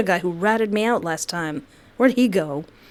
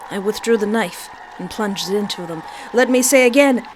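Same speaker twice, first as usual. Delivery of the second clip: ordinary talk, nothing marked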